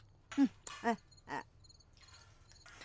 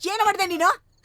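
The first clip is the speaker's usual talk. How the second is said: higher, louder alarmed questioning; alarmed mother; 6 semitones higher, 4 dB louder than usual. Acted. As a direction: rush in worried and loud. Mother finds daughter-in-law crying. (ಗಾಬರಿಯಾದ ಅತ್ತೆ ಜೋರಾಗಿ ಕೇಳುವುದು)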